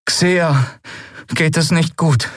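put-on high, downcast young voice; deeply troubled. A narrator's reading. Miko to captain about Xea, deeply troubled. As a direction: break bad news, pitched high. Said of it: "Miko klang tief bedrückt".

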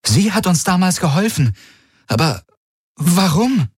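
high, amazed question; astonished. A narrator's reading. Kirai wonders aloud why Voi helped them, astonished. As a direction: marvel at what she did, pitched high. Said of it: "blinzelte erstaunt".

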